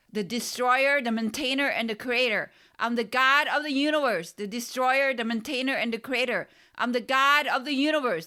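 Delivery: high, loud proclamation, chanted over and over; proclaiming shout. A narrator's reading. Narrator proclaims to the universe in a loud chant. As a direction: declare it like a chant of power. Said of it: "I shouted loudly".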